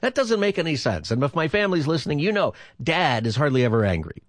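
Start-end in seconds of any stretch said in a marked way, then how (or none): 0.0-1.1 laughing through the words, pitch up. Real, not acted. Host laughing at caller's story about being asked if angry. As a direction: giggle while protesting it makes no sense. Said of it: "host laughing at himself".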